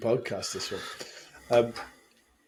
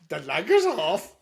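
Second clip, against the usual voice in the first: put-on higher, slow, botched Scottish accent; attempted Scottish accent; 6 semitones higher, slower than usual. Co-host's last go before cracking up, botched Scottish accent. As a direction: badly fake a drawn-out Scottish accent. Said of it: "in a Scottish accent".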